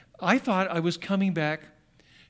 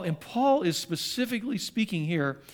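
ordinary talk

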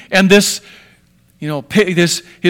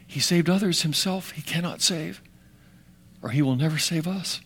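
muttered sneer; 3 semitones lower, 11 dB quieter than usual. Acted. Quoted mockers under breath.

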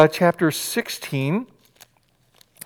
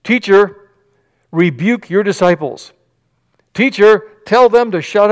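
raised, slowed, demanding plea; indignant Pharisees; 4 semitones higher, 6 dB louder than usual. Acted. Pharisees to Jesus, a raised indignant demand. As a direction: press the teacher hard, like an offended elder.

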